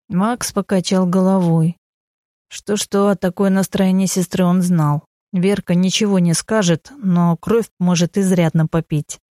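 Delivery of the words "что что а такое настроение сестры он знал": ordinary delivery, nothing marked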